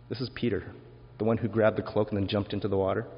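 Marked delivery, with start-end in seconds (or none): none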